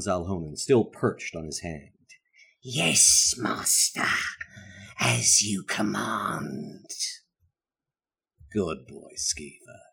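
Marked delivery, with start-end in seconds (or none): 2.6-6.9 servile imp, slow and hushed; hunchback assistant. Acted. GM voices the imp familiar. 8.5-9.8 softer, lower praise of a pet. Acted. Wizard praises the imp again.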